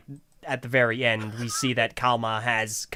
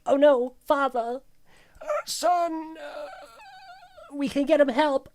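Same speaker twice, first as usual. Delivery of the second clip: hammed-up distraught son; 12 semitones higher than usual. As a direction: plead in a put-on high voice for father. Acting brother over dying father.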